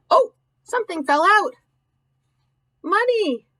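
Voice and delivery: high, delighted oh of discovery; surprised. Real, not acted. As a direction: sound amazed at what fell out. Reading aloud, surprised at discovery.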